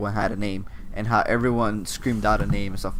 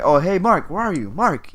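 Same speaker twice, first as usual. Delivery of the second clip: higher-pitched mock search call; imitated calling out; 7 semitones higher than usual. Speaker imitates enemies looking for each other, half-hearted call. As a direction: lightly sing out the name, as if searching. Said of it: "they're screaming out each other's names".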